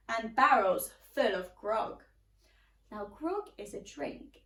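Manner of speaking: plain reading voice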